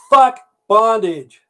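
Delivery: high, loud, slow drawn-out curse; punchline outburst. Retold or imitated. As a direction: holler it slowly, each word stretched. Punchline, first words after gag removed.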